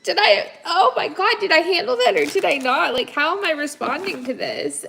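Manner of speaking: second-guess yourself in a panic; hammed-up self-doubt; mock anxious fretting. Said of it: "be like".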